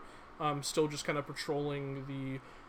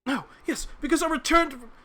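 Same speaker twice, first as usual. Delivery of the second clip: loud flustered talk; 11 semitones higher, 9 dB louder, faster than usual. Acted. Man flustered, interrupted.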